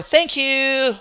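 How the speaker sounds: slow, wrong-sounding thank you; wrong stress. Shown as a demonstration. Teacher mocking a wrong thank you, slow and drawn.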